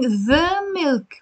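"the" drawn out before milk; stressed, lengthened "the", then milk. Shown as a demonstration. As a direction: lean slowly on "the". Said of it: "You're putting the the".

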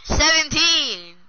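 loud, high, drawn-out answer; exasperated shout. Real, not acted. Kid answering the teaser about sister's age, shouting. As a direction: yell it slowly.

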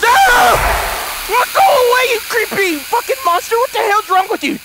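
high, loud panicked yelling; shouting. Real, not acted. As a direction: yell at the monster to go away. Monster appears in game.

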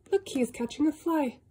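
ordinary delivery, nothing marked

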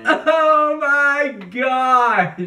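high, drawn-out wail of dismay; yelling. Real, not acted. Host reacts to the bad-smelling smoothie, wailing. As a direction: stretch it out slowly in high-pitched horror. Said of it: "Stop yelling".

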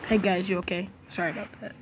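ordinary talk

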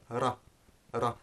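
"ra" with one quick tongue flap; single flapped R. Shown as a demonstration. Teacher isolating one flap for learners, single-tap R in "ra". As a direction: tap the R of "ra" once against the ridge. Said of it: "isolating a single one of those flaps".

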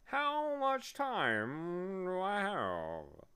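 slow, swooping bee voice; spelling bee. A narrator's reading. Bee correcting Milo's fear, slow pompous creature voice. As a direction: spell it out with drawn-out, sliding letters.

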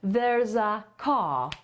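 very slow, "There's a car" slurred into one chain; linked there's a car. Shown as a demonstration. Teacher demonstrating words joined into one chunk, slow. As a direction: run "There's a car" together slowly. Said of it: "zlepkę w wymowie".

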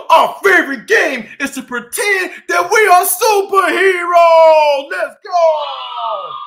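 excited. Acted. Excited superhero game pitch.

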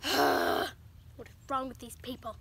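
exasperated dog voice. Retold or imitated. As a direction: drag it out like a fed-up pet. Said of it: "He's like".

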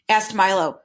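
plain reading voice

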